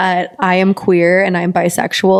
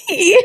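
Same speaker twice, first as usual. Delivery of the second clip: mock squeal. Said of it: "he's gonna listen ... be like".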